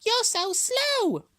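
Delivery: very high, loud, drawn-out hare voice; mocking hare. A narrator's reading. Hare laughs at plodding Tortoise, mocking, high and slow. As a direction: squeal the mockery, stretching the words. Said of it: "Hare laughed".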